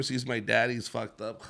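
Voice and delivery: ordinary delivery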